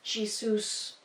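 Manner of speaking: Jesus said jee-SOOS, softly; harder pronunciation. Shown as a demonstration. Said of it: "Jesus is easier to say".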